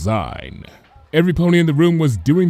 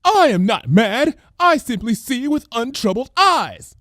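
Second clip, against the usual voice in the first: theatrical conspiracy preacher; grand announcement; 13 semitones higher, 3 dB louder than usual. Acted. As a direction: announce it leaning over a railing. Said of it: "announced Lyra".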